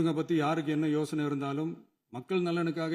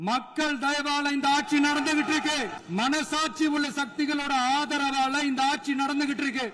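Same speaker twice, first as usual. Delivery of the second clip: real raised voice; 7 semitones higher than usual. Real, not acted. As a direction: speak up so opponents hear, pitch climbing and held high. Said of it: "நான் ஏன் கொஞ்சம் சவுண்டா பேசுறேன்".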